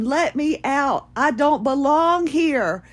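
high, drawn-out mock yelling; imitating a man shouting through a locked door; retold desperate plea. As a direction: plead to be let out, stretching the words. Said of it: "banging on the doors going".

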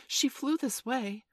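high, slow, uneasy; becoming troubled. A narrator's reading. Peter asking after Wendy, growing troubled. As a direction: draw it out with rising concern. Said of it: "becoming troubled".

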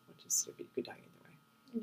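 whispered aside, almost breathless. Real, not acted. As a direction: drop to a whisper for the grim joke. Aside about flying fear.

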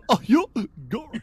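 goofy yokel yelp. Acted. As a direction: gulp it out like a happy simpleton. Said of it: "Why you sound like Goofy?".

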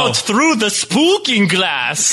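slow campy vampire pun; Count Jacula vampire voice. Acted. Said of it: "Count Jacula".

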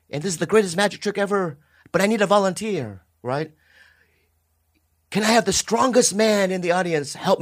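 high, grand stage announcer; showman announcer. Acted. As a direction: present it like a Vegas showman.

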